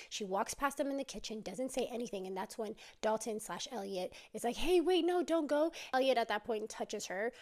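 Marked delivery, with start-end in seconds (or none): 4.6-5.7 loud, high plea to stay; imitated desperate "wait, don't leave"; urgent pleading. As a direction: call after someone storming off. Boyfriend calls after her leaving.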